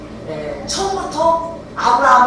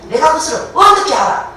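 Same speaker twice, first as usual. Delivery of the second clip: higher, louder challenge to the angel; argumentative retort; 5 semitones higher, 5 dB louder than usual. Acted. Zechariah talking back to an angel, arguing. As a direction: throw it back as an indignant demand. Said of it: "지금 따지는 겁니다".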